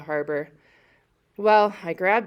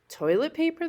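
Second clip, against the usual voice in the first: puzzled question; 10 semitones higher than usual. A narrator's reading. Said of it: "the nurse asked".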